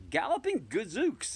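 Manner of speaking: high, loud exclamation of alarm; startled cry. Acted. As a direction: yelp it out in shock. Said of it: "I cried".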